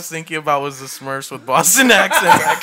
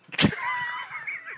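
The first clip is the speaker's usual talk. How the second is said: a burst of high-pitched laughter; high laughing shriek; 8 dB quieter than usual. Real, not acted. Friend cracking up as the take starts, high shriek of laughter. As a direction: break into a squealing laugh. Laughing after calling action.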